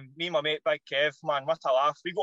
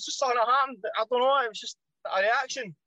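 baffled; 6 semitones higher than usual. Retold or imitated. His own baffled reply, retold.

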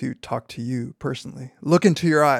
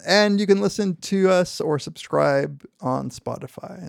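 ordinary delivery

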